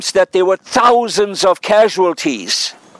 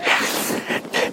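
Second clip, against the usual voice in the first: quiet breathy laugh; 10 dB quieter than usual. Real, not acted. Laughs at own joke.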